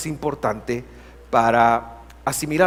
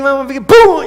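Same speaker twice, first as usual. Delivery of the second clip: sudden loud, high slap cry; hammed-up loud slap sound; mock exclaim; 11 semitones higher, 16 dB louder than usual. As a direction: punch the sound effect out. Retelling the slap.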